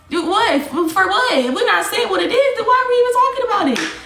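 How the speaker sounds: high-pitched, incredulous questioning; exasperated. Real, not acted. Streamer challenging the vague talk, exasperated. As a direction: let the pitch climb in disbelief. Exasperated at being told it's deeper.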